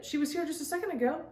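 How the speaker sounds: put-on sudden panic over the missing child; alarmed. Mia realizes Gabby has vanished, alarmed and quick.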